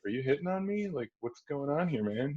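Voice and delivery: mock suspicious. Teller's mock suspicious reply.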